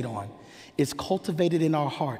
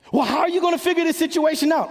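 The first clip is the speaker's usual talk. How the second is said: high, rushed voice of a puzzled doubter; acted incredulous challenge, fast and high; challenging question; 12 semitones higher, 4 dB louder, faster than usual. Voices puzzled people challenging the believer.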